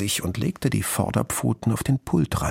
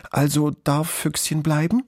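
put-on higher, hopeful child asking; pleading smile; 7 semitones higher than usual. A narrator's reading. Girl asking if Füchschen may stay, sweetly pleading. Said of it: "lächelte sie noch einmal ihr Bitte, bitte Lächeln".

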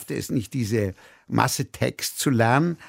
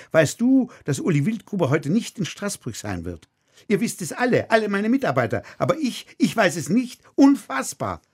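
higher and higher, pushing to an outraged peak; escalating rant; 6 semitones higher than usual. Acted. Director scolding his dramaturge in front of everyone, rising outrage. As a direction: build the outrage line by line.

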